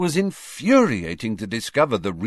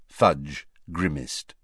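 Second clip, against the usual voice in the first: plain reading voice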